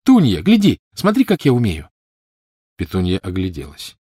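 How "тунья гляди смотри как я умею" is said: put-on high, loud little-girl call; excited child showing off. A narrator's reading. Lily showing her sister a trick, excited. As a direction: call your sister over to watch.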